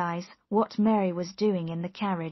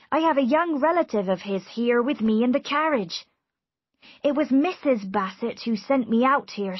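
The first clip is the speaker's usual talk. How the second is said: slightly loud, raised servant's report; eager servant; 4 semitones higher, 5 dB louder than usual. A narrator's reading. Servant reports to his master.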